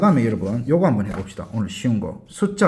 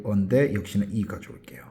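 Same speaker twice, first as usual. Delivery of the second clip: whisper; 4 semitones lower, 5 dB quieter, faster than usual. Real, not acted. Teacher explains spelling aside.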